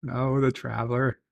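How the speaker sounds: usual speaking voice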